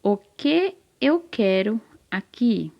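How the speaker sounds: slow, measured sentence reading with pauses; careful reading aloud. Shown as a demonstration. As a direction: spell out the sentence slowly, group by group. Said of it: "Então, a frase é". (leitura didática, bem pausada)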